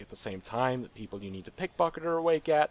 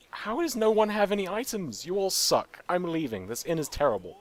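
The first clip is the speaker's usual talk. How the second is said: higher, louder exasperated complaint; exasperated outburst; 9 semitones higher, 7 dB louder than usual. Real, not acted. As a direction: vent at the useless inn. Frustrated at empty inn.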